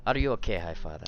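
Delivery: lightly voiced accented follower checking on father; Shen's character accent. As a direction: ask after father in the put-on accent. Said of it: "Let me get the right one".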